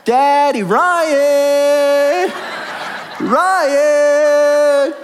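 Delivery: high, loud, drawn-out calling of Daddy and Ryan; imitated toddler hollering. Preacher imitating his daughter hollering from her bed, high and drawn out. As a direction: call out across the house like a three-year-old. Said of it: "and she's going".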